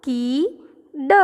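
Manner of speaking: slow કી, pause, then ડ; blended reading. Shown as a demonstration. Teacher reading the whole spelling with children, slowly sounded out. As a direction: stretch કી, then add ડ separately. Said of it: "આપણે આખો spelling વાંચીશું".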